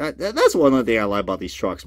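ordinary talk

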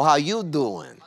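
playful New York greeting; New York street hello, hammed up; New York accent. Shown as a demonstration. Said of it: "I'm from New York".

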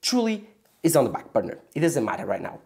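usual speaking voice

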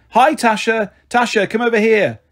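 high, eager call across a playground; lifted, excited calling voice. Acted. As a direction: hail someone brightly from afar. Mother calls to girl across playground.

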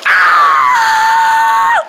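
high, hoarse failing screech; strained scream. Acted. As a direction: try to scream without a voice. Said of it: "hard for me to even scream".

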